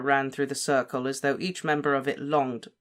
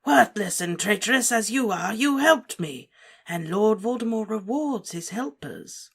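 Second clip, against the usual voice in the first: Voldemort; 7 semitones higher than usual. A narrator's reading. Voldemort rewards Wormtail.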